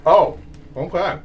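chewing while talking; muffled through food; mouth full. Real, not acted. Seen 0: Older man bites pizza just before; chews, hand over mouth while speaking.

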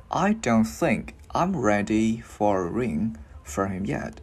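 slow, careful read-aloud for learners; unhurried teacher's demonstration; slow model reading. Shown as a demonstration. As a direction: give the sentence slowly, word by word. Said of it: "mình cứ đi từ từ vào thôi".

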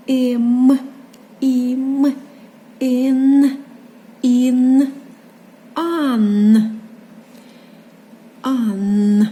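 Ем, ім, ін, ан each drawn out evenly; reading syllables aloud. Shown as a demonstration. Teacher reading syllables for children, Ем, ім, ін, ан slowly stretched. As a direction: stretch both sounds in Ем, ім, ін, ан, pausing between. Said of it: "Ось читаємо склади".

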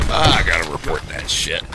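low-pitched mimicry of a player's grumble; imitated complainer. Gamer mimicking a player about to report, imitated grumble. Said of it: "Just the way he said it".

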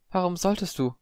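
high, small, puzzled voice; bewildered question. A narrator's reading. Padma baffled by Harry's offer, bewildered. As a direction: ask it with the fight gone.

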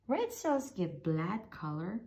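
plain reading voice

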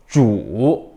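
쭈 low dip, then up; third tone. Shown as a demonstration. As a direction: sink 쭈 low and let it rise. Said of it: "삼성".

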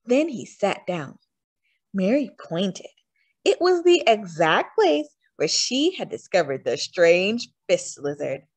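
ordinary delivery

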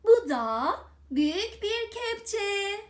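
ordinary talk

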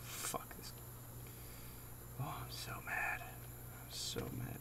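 frustrated slow whisper. Real, not acted. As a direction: whisper in annoyed dread.

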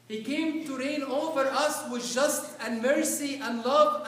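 loud, high, rousing sermon voice; full-force preaching. Acted. Preacher to congregation telling of the shouting prisoners, fiery sermon delivery. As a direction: preach it out, stirring the hall.

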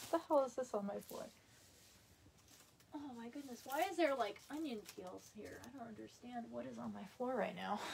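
soft, baffled muttering to herself; puzzled muttering. Real, not acted. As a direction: mutter it, confused by the mess. Finds mess on her floor.

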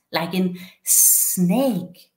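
"snake" stretched very slowly, hissing the s; drawn-out snake sound. Retold or imitated. Teacher sounding out the letter S for a child, stretching the s in "snake". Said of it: "tries to explain the sounds of different letters".

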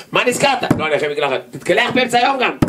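high mocking banter between two voices; a playful mock exchange; mocking dialogue. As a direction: tease him, pitched well up. Mock dialogue over perfume.

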